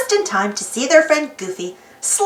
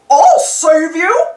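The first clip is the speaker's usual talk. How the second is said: slow, loud heroic call; heroic cry; 5 semitones higher, 5 dB louder, much slower than usual. Acted. Goofy leaps to save Donald's burning float: slow loud heroic cry. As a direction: shout it like a brave rescuer. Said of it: "Goofy cried".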